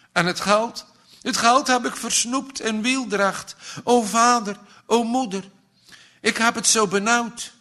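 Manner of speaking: high, pleading, agitated voice; distressed boy pouring out his guilt; anguished confession. A narrator's reading. Feverish boy confesses to his parents.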